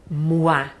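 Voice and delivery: mois with oi said as wa; mois, mwa, silent s; French mois. Shown as a demonstration. Teacher showing students oi in mois. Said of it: "eu pronuncio o A".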